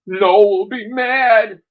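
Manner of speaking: high, slow, loud mock voice; mocking loud drawl. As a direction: taunt it slowly. Mocking the warning.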